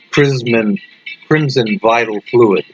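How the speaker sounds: usual speaking voice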